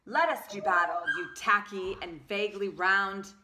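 loud, grand battle challenge; Scissors challenging. Acted. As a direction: challenge the tape like a proud swordswoman.

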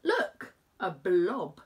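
usual speaking voice

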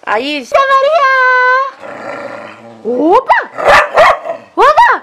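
startled call. Real, not acted. Calling out, startled.

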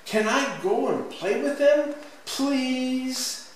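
high-pitched begging, drawn-out please; pleading child. A narrator's reading. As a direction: beg like an eager little kid. Child begs mother to play.

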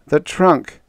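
high, weakened voice; weak. A narrator's reading. Beaten prisoner confessing where it is, weakly. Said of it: "he said weakly".

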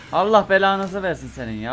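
loud, exasperated cursing at the enemy; angry curse. Real, not acted. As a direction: spit the curse out in real frustration. Cursing enemy chasing him.

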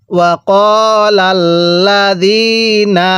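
ওয়াকালাল্লাযীনা very slow, long vowels held; soft-zal recitation. Shown as a demonstration. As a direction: soften the z in ওয়াকালাল্লাযীনা to "dh". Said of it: "আমি এই লাইনের শুরু থেকে পড়ব".

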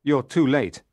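loud cry of dismay; cried out. A narrator's reading. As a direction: blurt the bad news out. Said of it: "I cried".

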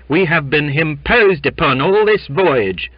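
loud, high gruff complaint. A narrator's reading. Sailor airing grievance to the captain, gruff. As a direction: grumble forcefully out of the crowd. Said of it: "said a gruff voice".

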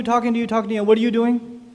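ordinary talk, nothing marked

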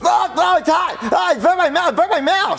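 high, panicked cries of pain; pained yelping. Acted. Boy who just bit scalding pizza, pained wailing. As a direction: yelp through a burnt mouth, words slurred. Mouth burnt by hot pizza.

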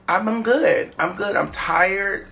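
ordinary talk, nothing marked

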